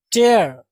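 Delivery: "टेयर" loud, rhyming with air; सही उच्चारण. Shown as a demonstration. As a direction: say "टेयर" as TAIR, firmly. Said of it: "ये टीयर नहीं". ("टेयर" में 'ए' की आवाज़, ज़ोर से)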